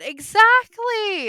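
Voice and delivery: excited. Real, not acted. Cheering agreement on dad bods.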